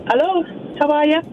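Irish-accented hello. Real, not acted. Caller back on the phone to the hosts, Irish accent. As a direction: greet them with an Irish lilt. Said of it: "you've got an accent now".

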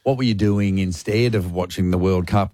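ordinary talk

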